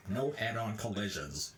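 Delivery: put-on bored teen on the intercom; lazy, unenthused announcement; half-hearted intercom yell. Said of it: "gets on the intercom and half-heartedly yells".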